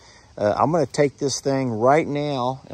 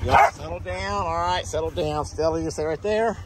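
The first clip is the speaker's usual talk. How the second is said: higher, coaxing pet voice; talking to the dogs; 8 semitones higher than usual. Real, not acted. Owner settling Stella and the dogs in the cab, higher coaxing voice. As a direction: soothe and scold the pups gently.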